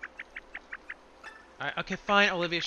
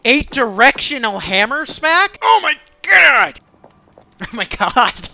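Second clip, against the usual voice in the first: ordinary talk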